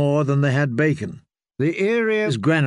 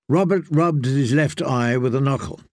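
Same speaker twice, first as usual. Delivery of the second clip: plain reading voice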